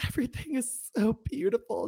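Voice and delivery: high, airy mock gush; gushing quote. Widow at the funeral home, mock gushing. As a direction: gush over the flowers like a sweet widow. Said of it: "she's like".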